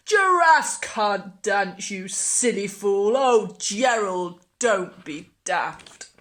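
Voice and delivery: slow, loud sneering taunt; mock him like a playground bully. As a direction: animals jeering at the clumsy giraffe. Animals taunt Gerald.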